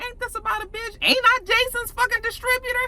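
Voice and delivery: outraged disbelief. Retold or imitated. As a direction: squeak out the betrayal in disbelief. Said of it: "Tommy was like".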